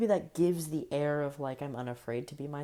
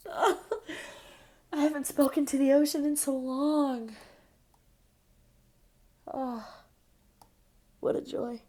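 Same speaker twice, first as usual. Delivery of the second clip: high giddy gush; excited; 8 semitones higher than usual. Real, not acted. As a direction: gush with delight.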